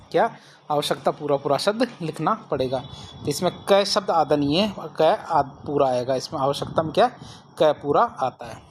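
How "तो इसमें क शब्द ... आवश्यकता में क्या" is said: ordinary talk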